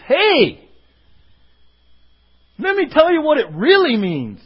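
smooth charismatic teacher. Retold or imitated. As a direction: sell it like a smooth talker. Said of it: "somebody who sounds good".

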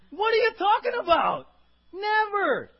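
high, loud indignant cry; Peter's rebuke. Pretend. Peter rebuking Jesus, high and loudly indignant. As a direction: refuse it outright, shocked. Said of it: "he begins to rebuke".

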